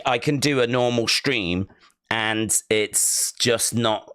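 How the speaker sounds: ordinary delivery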